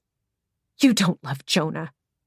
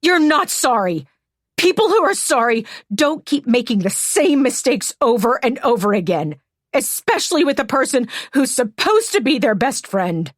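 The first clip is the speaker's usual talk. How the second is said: higher and louder, scornful; angry rebuke; 7 semitones higher, 4 dB louder than usual. Acted. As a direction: throw the apology back at her. Hannah rejects the apology.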